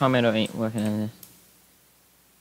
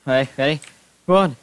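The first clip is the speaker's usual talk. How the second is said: ordinary talk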